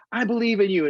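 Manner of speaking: high, excited call; imitated excited kids. Freshmen running around calling to each other, high and excited.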